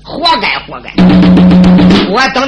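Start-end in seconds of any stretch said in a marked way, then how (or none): 0.6-0.9 soft echo. Acted.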